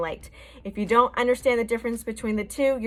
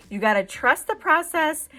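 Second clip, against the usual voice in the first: bright, higher pep-talk line; upbeat catchphrase; 6 semitones higher than usual. Real, not acted. Makeup artist reassuring viewers, upbeat and higher. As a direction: cheer them on to trust it.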